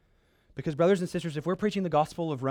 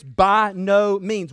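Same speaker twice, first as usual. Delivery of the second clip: BY NO MEANS said loud and very slow; emphatic refusal; 2 semitones higher, 7 dB louder, much slower than usual. Acted. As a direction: hammer out each word of "By no means". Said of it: "strongest emphatic no that we can find".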